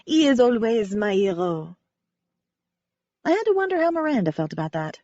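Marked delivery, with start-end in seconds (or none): 0.0-1.8 lightly voiced French ballerina, sincere; a hint of French accent, remembering gratefully. Ballerina Cece telling the amateur sleuth how Wynne saved her, light French accent. Cece's voice continues.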